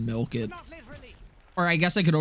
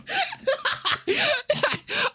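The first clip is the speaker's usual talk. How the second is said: high-pitched helpless laugh; real burst of high laughter. Real, not acted. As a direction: crack up at your own silliness. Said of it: "I'm laughing because this is".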